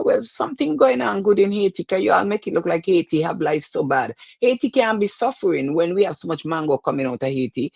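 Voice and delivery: ordinary delivery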